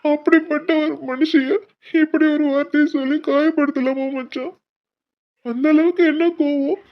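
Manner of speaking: hurt and furious on his mother's behalf; anguished anger. Acted.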